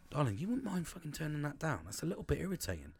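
calm polite request. Retold or imitated. Father gently asking his daughter to turn it down, soft. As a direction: ask it softly, no edge. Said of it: "what I could have done was just gone".

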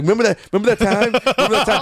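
fast, high, gushing fan; geeking out. Retold or imitated. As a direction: blurt it like a starstruck fan. Fan geeking out at Paul Reiser.

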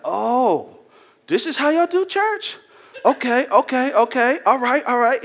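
high, loud, amazed church visitor voice; excited visitor. Retold or imitated. Newcomer at a lively church, high excited exclamation.

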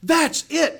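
high, loud excited confirmation. Retold or imitated. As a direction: cheer the discovery on. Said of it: "man, he got excited".

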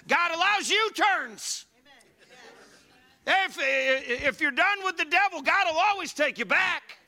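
high, loud preacher's shout, slowed; preaching at full force. Acted. Pastor shouting God's mercy to the congregation, full force preaching. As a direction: build to a ringing shout of promise.

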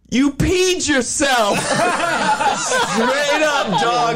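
slow, high, laughing shout; laughing quote. Retold or imitated. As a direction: crack up as you deliver the punchline. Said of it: "first things out of her mouth were".

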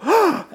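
a sharp, high frightened gasp; startled gasp. Retold or imitated. The crowd hears a miracle, sharp startled gasp. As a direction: gasp like someone who is suddenly shocked. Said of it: "It's caused them to go".

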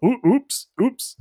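high sing-song oops; mock oops. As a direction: fake an innocent accident. Mock accident voice.

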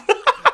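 sudden high loud laugh; a loud squeal of a laugh; burst of laughter. Real, not acted. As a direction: crack up out loud. Bursts out at Ireland joke.

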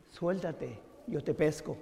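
soft, low voice from above. Retold or imitated. Voice tells hanging man to let go, softly. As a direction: drop to a calm, quiet offer. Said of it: "La voz le dice".

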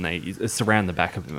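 ordinary delivery

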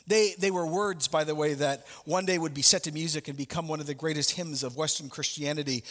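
ordinary talk

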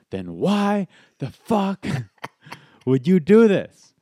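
high, drawn-out angry demand; imitating a furious confrontation; mad. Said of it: "And he's mad".

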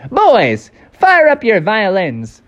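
high cartoon bandleader cricket; high, punchy little-cricket voice; Jiminy Cricket. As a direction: call the band to start. Jiminy orders cricket band.

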